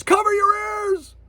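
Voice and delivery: high, loud shouted warning. Retold or imitated. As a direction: yell it over the blast. Someone yells in movie scene.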